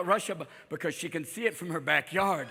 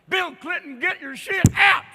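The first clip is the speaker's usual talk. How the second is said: high, loud, furious yelling; trailer park yell; 9 semitones higher, 6 dB louder than usual. Acted. Hillary yelling at Bill Clinton on the lawn, high furious yell. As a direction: throw him out, screaming.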